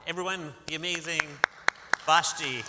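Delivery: announcer call. Real, not acted. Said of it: "announcing the girl to audience".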